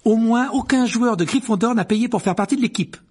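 put-on high, loud, indignant girl; curt, high-pitched rebuke; sharp retort. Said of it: "dit sèchement Hermione".

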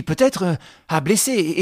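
usual speaking voice

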